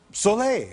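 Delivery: "Soleil" said in French, lifted up; French word. Retold or imitated. To a villager on a sunny day, French "Soleil". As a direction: offer "Soleil" as small talk. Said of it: "I go".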